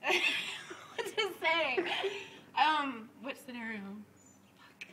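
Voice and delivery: normal talking voice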